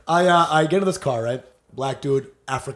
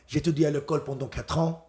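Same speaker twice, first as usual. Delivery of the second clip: high school French; 3 semitones lower, 9 dB quieter, faster than usual. Retold or imitated. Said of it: "break out some of that fucking high school French".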